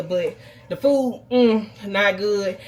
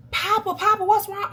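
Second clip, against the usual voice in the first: much higher, a child's frightened cry, imitated; upset child calling; 11 semitones higher than usual. Mother voicing her son crying to his granddad, frightened and high. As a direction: voice the little boy calling his papa in panic.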